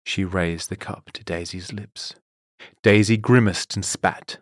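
plain reading voice

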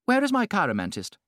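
clear, high society hostess call; clear contralto. A narrator's reading. Lady Windermere asks her guests for her palmist. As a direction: call out to the crowded gallery. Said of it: "said in her clear contralto voice".

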